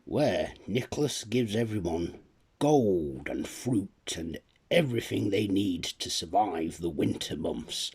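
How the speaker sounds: plain reading voice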